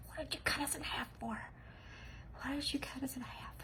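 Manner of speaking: high, hushed, quick little creature voice; mock haunting. Cut-up little guys haunting her in dreams, mock haunting. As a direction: play tiny accusing ghosts in a half-whisper. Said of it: "friends with hatchets will probably chasing me".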